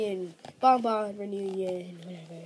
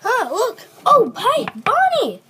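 squeaky excited voice; 14 semitones higher, 7 dB louder than usual. Acted. Squeaky character greets Bonnie.

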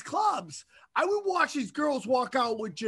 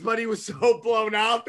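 normal talking voice

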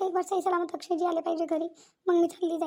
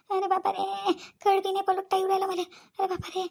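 in pain. Acted. Groaning after being beaten.

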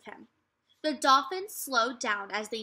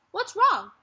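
louder, higher worried call; alarmed call; 9 semitones higher, 7 dB louder than usual. A narrator's reading. Brother calling back to hurt sister, loud and high. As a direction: snap round and call out.

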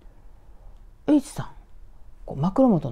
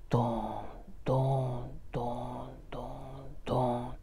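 hushed heavy thuds, evenly spaced; imitated distant footsteps; 6 semitones lower, 11 dB quieter than usual. Storyteller imitating footsteps nearing the bedroom, soft low booms. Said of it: "なんか足音みたいな".

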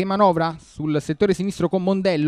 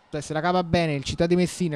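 ordinary talk, nothing marked

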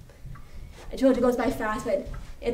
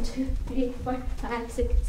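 ordinary delivery

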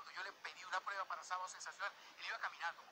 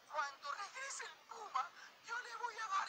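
weepy diva; slower than usual. Retold or imitated. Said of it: "ella decía".

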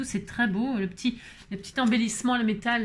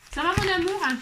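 pet talk; 7 semitones higher than usual. Real, not acted. Asking her pet if it's okay.

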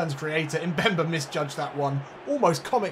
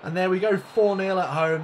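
excited; 5 semitones higher, 7 dB louder than usual. Real, not acted. Celebrating the fourth goal.

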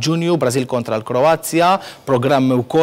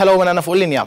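raised, higher hello down the phone line; calling out; 6 semitones higher, faster than usual. Real, not acted. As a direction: call out to find the caller on the line. Host calling out to caller on line.

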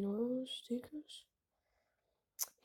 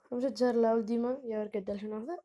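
normal talking voice

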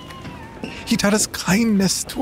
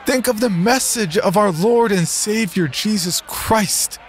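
high, raised preaching appeal; earnest raised appeal to the crowd; 11 semitones higher than usual. Acted. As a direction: call them back to their faith. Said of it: "I regaled them".

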